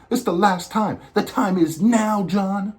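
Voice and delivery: high-pitched, insistent plea; mock-exasperated urging. Fan urging John Cena to chase the title now, mock exasperation. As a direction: press him like a fed-up fan.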